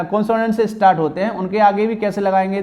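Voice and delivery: usual speaking voice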